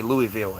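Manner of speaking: Louisville as LOO-ee-vill; mispronounced Louisville. Shown as a demonstration. Showing how outsiders say it, Louisville as LOO-ee-vill. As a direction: say Louisville with a clear EE in the middle. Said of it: "One hundred percent they say".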